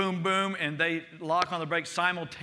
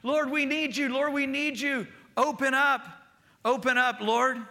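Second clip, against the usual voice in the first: pleading prayer; 7 semitones higher than usual. Acted. Believer knocking on God's door, high pleading prayer. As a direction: beg God to open up.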